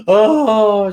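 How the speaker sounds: loud, high mock wail; imitated crying. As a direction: burst into a pretend sob. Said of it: "she starts crying".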